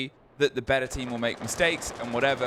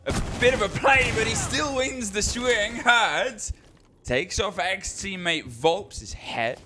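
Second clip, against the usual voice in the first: excited play-by-play; 7 semitones higher, slower than usual. Real, not acted. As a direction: call the big moment, stretching the key words. Live esports play-by-play at a peak.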